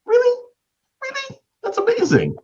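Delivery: very high, astonished voice; retold high amazed gasp; amazed disbelief. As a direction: squeal out your disbelief. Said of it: "I was like".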